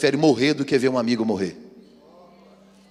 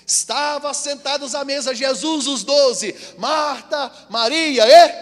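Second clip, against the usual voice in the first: high, slow, loud preaching; full-force pulpit voice; 11 semitones higher, 6 dB louder, slower than usual. Acted. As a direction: proclaim the scripture slowly.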